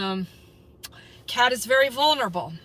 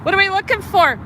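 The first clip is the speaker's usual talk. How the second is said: calling out; 8 semitones higher, faster than usual. Real, not acted. Livestreamer shouting a question at cops, calling out. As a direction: call it out across the street.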